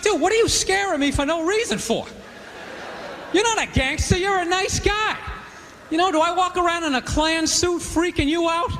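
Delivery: high-pitched indignant complaint; mock pissed off. Comic scolding the friend who scared him, mock indignant. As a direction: protest like an offended pal, played for laughs. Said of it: "I'm kinda pissed at him".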